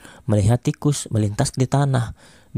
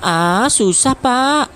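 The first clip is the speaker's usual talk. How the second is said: put-on high whiny kid voice giving up; squeaky child complaining to teacher; whining pupil; 12 semitones higher, 4 dB louder, much slower than usual. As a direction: whine it like a stuck schoolkid.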